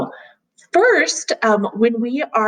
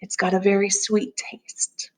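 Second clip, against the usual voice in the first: calm grounding exercise. Acted. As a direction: gently guide them through noticing.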